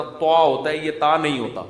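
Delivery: ordinary talk, nothing marked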